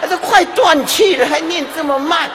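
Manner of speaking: high, loud mock outrage; comic impatient yell. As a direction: cry out in hammed-up exasperation. Acts dying person's complaint.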